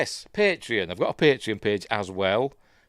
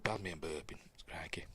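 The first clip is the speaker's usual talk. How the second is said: whispered; 5 semitones lower, 16 dB quieter, faster than usual. Real, not acted. Embarrassed after burping.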